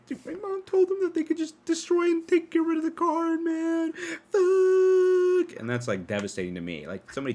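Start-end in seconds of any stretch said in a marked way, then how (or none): 0.1-5.6 put-on much higher, whiny kid's complaint; upset kid voice. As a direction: play the friend wailing about his loss. Friend retells mom destroying card.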